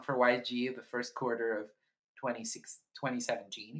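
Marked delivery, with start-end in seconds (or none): none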